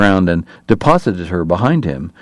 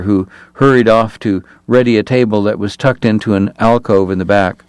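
usual speaking voice

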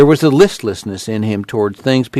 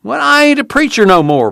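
high-pitched protest; reluctant ex-preacher's protest; 13 semitones higher than usual. A narrator's reading. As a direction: object earnestly, pitch way up. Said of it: "Casey protested".

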